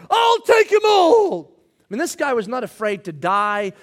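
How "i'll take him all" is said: high, slow roaring shout; battle cry. Acted. Peter shouting as soldiers come for Jesus, roaring battle cry. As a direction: bellow it like a man charging soldiers. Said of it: "this is Peter's reaction".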